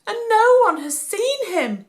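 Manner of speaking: high, pleading, near tears; lightly voiced weeping mother; tearful mother. A narrator's reading. Mary tells Joseph nobody has seen him, near tears. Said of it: "Mary wept".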